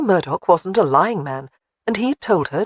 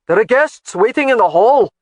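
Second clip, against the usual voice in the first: louder, raised call after her; 5 semitones higher, 8 dB louder than usual. Acted. Alec calling after Jamie about the guests, louder. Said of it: "he called out to her".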